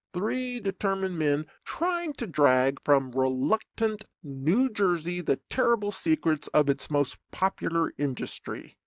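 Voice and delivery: ordinary delivery, nothing marked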